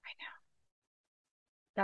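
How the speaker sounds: real whispered agreement; hushed sympathy. Real, not acted. Host answering the advocate who is near tears, hushed.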